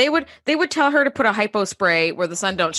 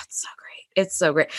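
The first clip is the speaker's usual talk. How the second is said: awed whisper; 5 semitones lower, 4 dB quieter than usual. Real, not acted. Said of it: "awed about the set".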